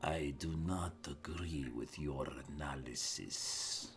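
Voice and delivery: a watch captain holding back threat, hushed then flaring; city watch captain. Acted. GM voices captain again.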